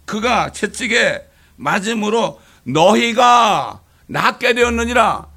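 very slow, loud, solemn verse reading; slow scripture recital. Acted. Pastor reciting Peter's verse to the church, slow and solemn. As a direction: lay out each word of the verse slowly.

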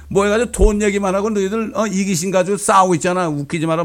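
loud, high-pitched furious scolding; imitating a teenager yelling at the UN; angry speech. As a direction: tear into the delegates like an outraged teen activist. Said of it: "유엔에 가서 연설하잖아요".

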